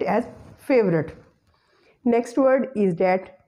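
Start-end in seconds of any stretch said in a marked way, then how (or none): 0.6-1.1 "favorite" as two syllables, FAVE-rut; schwa after V deleted. Shown as a demonstration. Teacher showing the deleted schwa, "favorite" said as FAVE-rut. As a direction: run V straight into R in "favorite". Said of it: "you will pronounce it".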